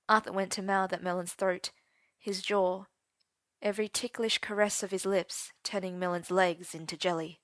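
plain reading voice

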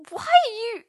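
loud, high-pitched startled squawk. A narrator's reading. Merlin to Arthur toppling through the door, startled squawk. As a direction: squawk it out in shock. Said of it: "Merlin squawked".

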